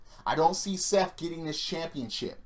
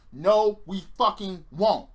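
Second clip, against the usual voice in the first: louder, slow, higher defiance; defiant refusal; 4 semitones higher, 5 dB louder, slower than usual. Real, not acted. Fan answering WWE's plan for him, defiant refusal. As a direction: push back hard, drawing out each word. Fans' angry answer to mimicked WWE.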